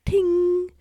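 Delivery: high, loud ringing ting; ping it out like struck metal; bright-struck disc sound effect, high and held; ringing metal noise. Acted. Voicing the thrown disc hitting.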